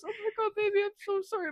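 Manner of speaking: high-pitched imitation of an apology; mock apologetic. Boyfriend apologizes to angry girlfriend, mock pleading. Retold apology after punch.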